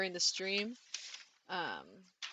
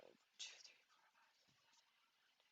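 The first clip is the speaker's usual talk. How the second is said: whispered counting; 28 dB quieter than usual. Real, not acted. Counting Lego studs under breath.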